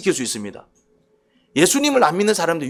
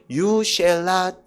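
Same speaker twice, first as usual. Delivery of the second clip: drawn-out reading; much slower than usual. Shown as a demonstration. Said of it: "엔아이브이를 보면".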